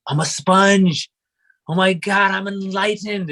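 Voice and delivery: high, slow, dreamy self-mockery; young student gushing about enlightenment, high and slow; mock enlightened. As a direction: play your younger self awestruck and high. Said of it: "I was just like".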